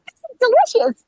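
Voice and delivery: high, loud goofy puppet. Shown as a demonstration. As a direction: squeal it like a silly puppet. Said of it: "in my puppet voice".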